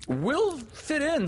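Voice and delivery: put-on high, drawn-out boys' assurance; eager assurance. A narrator's reading. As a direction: assure high and slow, all keenness. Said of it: "they assured him".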